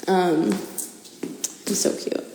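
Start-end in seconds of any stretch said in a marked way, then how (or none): 1.7-2.2 whispered. Real, not acted. Whispered aside.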